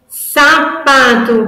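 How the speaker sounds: slow syllable-by-syllable sapato; syllables split apart. Shown as a demonstration. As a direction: set out sapato before removing a syllable. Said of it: "a tia vai tirar o sa".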